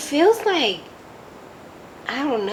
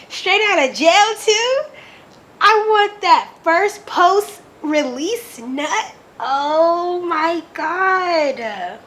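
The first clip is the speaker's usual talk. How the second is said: mock swooning; 6 semitones higher, slower than usual. Real, not acted. Gushing over the book's scene.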